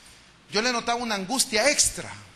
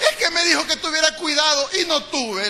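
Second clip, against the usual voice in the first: put-on child's excuse; 7 semitones higher, 5 dB louder than usual. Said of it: "le dije".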